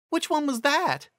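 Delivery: very high, curious; high pony voice. A narrator's reading. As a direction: ask it very high. Carrot Top voiced, very high.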